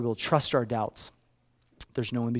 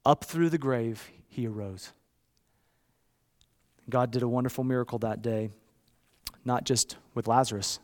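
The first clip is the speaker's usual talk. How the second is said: ordinary talk, nothing marked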